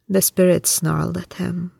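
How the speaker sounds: usual speaking voice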